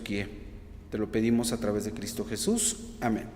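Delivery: soft, earnest prayer voice; oración. Acted. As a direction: pray humbly and quietly to the Lord. Opening prayer before the sermon. (oración suave y reverente)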